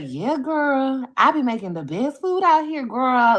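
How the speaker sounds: hammed-up sassy accent, loud and high; Cassie. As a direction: mimic a sassy woman bragging, with an accent. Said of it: "the most sassiest attitude".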